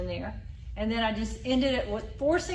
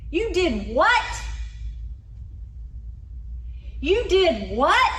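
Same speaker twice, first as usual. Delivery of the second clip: slow, louder, higher "You did what?" rising on "what"; confused disbelief; 7 semitones higher, 4 dB louder, slower than usual. Shown as a demonstration. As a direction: drag out the shocked question. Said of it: "a kind of confusion".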